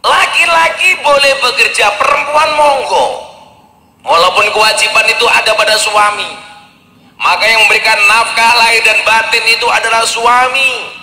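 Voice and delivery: rally the men to work; fiery preaching. Acted. Preacher urging husbands to earn, full force.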